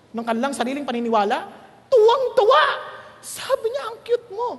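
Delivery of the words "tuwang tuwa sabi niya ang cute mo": high and bright, thrilled squeal; delighted. Retold or imitated. Preacher retelling a host who adored him, delighted and high. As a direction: gush it like a delighted fan.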